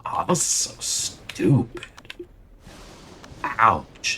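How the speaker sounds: slow whispered groan of disgust; frustrated whisper. Real, not acted. Streamer fed up with his game, whispering. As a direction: breathe it out, fed up.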